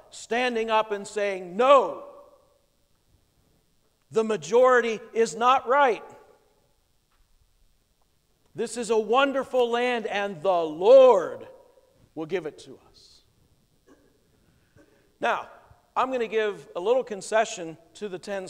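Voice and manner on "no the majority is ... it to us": defiant declaration. Retold or imitated. Two spies defy the majority before the assembly, louder. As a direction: stand up against the majority.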